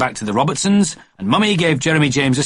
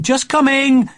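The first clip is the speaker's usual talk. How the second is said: much higher call from another room; calling back; 14 semitones higher, 4 dB louder, slower than usual. A narrator's reading. Mummy answering Daddy from upstairs, high calling reply. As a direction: sing it out from upstairs. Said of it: "Mummy kept shouting".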